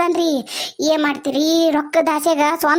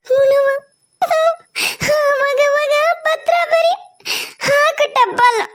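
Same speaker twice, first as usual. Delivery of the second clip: much higher, sobbing, slowed; weeping; 5 semitones higher than usual. Acted. Mother begging daughter to write, weeping. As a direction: plead through sobs to write and visit.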